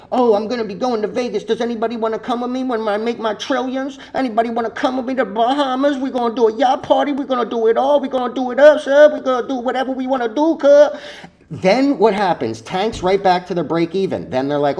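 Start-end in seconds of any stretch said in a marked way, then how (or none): 0.1-11.0 lampoon a cocky trader dreaming of yachts; mocking a trader bragging online about his trillions; mocking euphoric trader. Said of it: "When you start seeing people saying".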